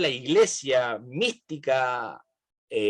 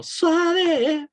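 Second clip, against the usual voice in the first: high drawn-out lilt. Real, not acted. Playful lilt on suggested word.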